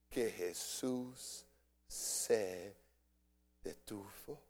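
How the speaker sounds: preacher letting the key line land softly; hushed refrain. Acted. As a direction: let the words sink to a hush.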